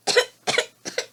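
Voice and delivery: little pretend coughs; acted cough. Reader coughing along with the flea, pretend coughs. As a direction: cough three short times as the flea. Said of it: "No one heard him cough".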